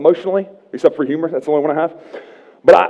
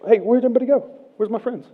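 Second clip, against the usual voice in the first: mock lost and alone; 8 semitones higher, 4 dB quieter, faster than usual. Broke man looking for friends.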